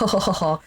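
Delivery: mock "oh-ho-ho" laugh; imitated laughing. She teases him as they start laughing, imitated laugh. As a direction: act out their shared laugh. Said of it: "like, start laughing".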